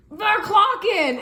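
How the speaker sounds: high, loud, drawn-out mock yell; retold screaming. Imitating Jacob waking her in the night, mock screaming. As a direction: imitate someone yelling in the night.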